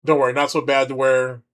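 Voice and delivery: ordinary talk, nothing marked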